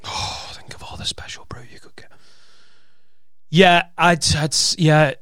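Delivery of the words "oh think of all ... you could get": hushed, tempting whisper; very soft whispered daydream; whispered aside. Pretend. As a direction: whisper it longingly under your breath.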